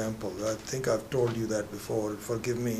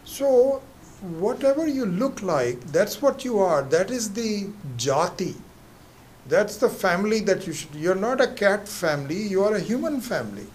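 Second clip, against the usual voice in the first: emphatic lecture; 7 semitones higher, 5 dB louder than usual. Acted. Psychiatrist lecturing the man.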